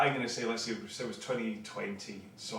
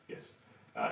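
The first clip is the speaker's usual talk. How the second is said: ordinary delivery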